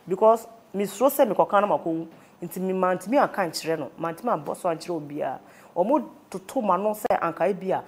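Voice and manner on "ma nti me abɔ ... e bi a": ordinary delivery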